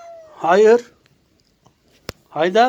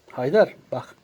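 usual speaking voice

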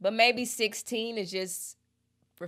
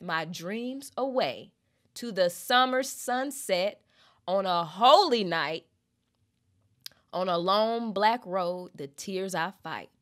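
slow lyric reading; 3 dB louder, slower than usual. Acted.

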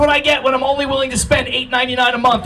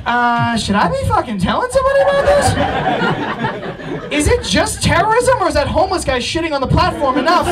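high, fretful self-questioning; anxious commuter; 5 semitones higher than usual. Retold or imitated. Comic New Yorker wondering whether to report it, high and fretful. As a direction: voice climbing up in nervous doubt. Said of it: "where you're like".

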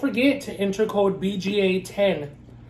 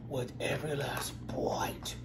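whisper; 5 semitones lower, 10 dB quieter than usual. Real, not acted. Whispered vow during mukbang.

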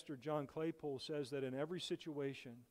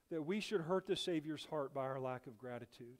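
ordinary talk

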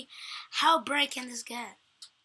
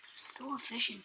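whispering; 7 dB quieter, slower than usual. Real, not acted. Quiet aside while playing.

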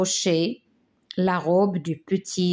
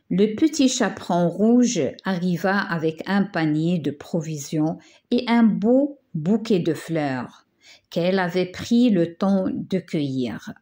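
ordinary delivery

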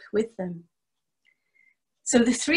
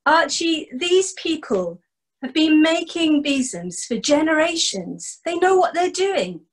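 exasperated scolding; 6 semitones higher than usual. Acted. Donald scolds Archie.

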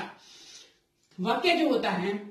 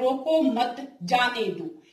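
रोको मत said together, then जाने दो; slow, break the phrase after मत; group it as रोको मत, pause, जाने दो; much slower than usual. Shown as a demonstration. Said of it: "अगर इसमें थोड़ी दूरी आ जाए".

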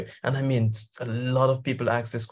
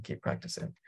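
whispered repeat; whispered echo, keep practicing; 14 dB quieter than usual. Real, not acted. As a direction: breathe it out under your voice.

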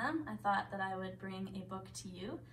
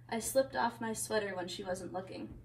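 plain reading voice

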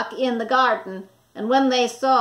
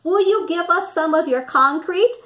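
higher, louder polite plea; little wolves; 6 semitones higher, 5 dB louder than usual. A narrator's reading. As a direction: ask sweetly like small wolves. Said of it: "asked the three little wolves".

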